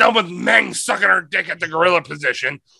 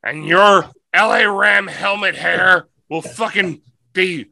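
normal talking voice

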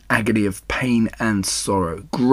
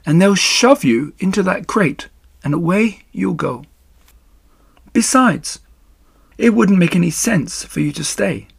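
higher-pitched, composed spider voice; Charlotte, reasoning; 7 semitones higher than usual. Acted. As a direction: patiently lay out the hard facts.

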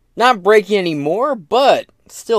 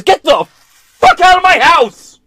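much higher, loud, slow yelled outburst; mock furious yell; 13 semitones higher, 8 dB louder than usual. Host imitating Biden yelling at a guest, hammed-up furious shout. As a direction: scream him out of the room. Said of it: "he goes".

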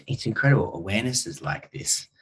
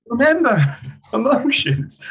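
high, loud, drawn-out mock reminder with a laugh; hammed-up calling out; 7 dB louder than usual. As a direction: shout it slowly as if through a door, laughing. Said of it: "double banging on the door".

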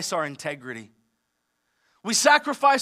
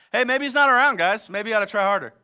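mocking Elijah taunt; 3 semitones higher, 5 dB louder, faster than usual. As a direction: tease them like a bored bystander. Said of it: "leaning up against the wall, like".

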